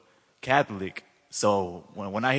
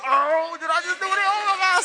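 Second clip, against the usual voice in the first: very high, loud squeal of joy, re-enacted; overwhelmed crying-laughing; 10 dB louder than usual. Retelling his first prayer in tongues, overwhelmed crying and laughing. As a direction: gush in a high, tearful-happy mock wail. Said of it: "I'm like crying and stuff, laughing".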